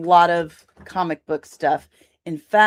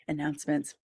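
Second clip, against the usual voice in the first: soft, lower repeat of ANNOUNCEMENTS; quiet echo; 5 semitones lower, 9 dB quieter, faster than usual. Pretend. Host repeating the segment title after the big call, quiet echo. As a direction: drop to a small, quiet ANNOUNCEMENTS.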